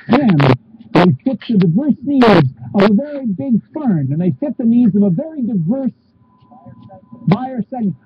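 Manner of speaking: polished sales pitch voice; upbeat RV commercial narration; ad read. Acted. Reads ad copy on camera.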